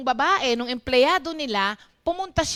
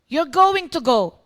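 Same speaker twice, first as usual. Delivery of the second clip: bossy order; 7 dB louder than usual. Retold or imitated. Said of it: "Boss kasi siya eh".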